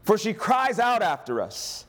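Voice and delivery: high, slow, drawn-out urging. A narrator's reading. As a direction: read it as an insistent demand. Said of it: "came and urged him".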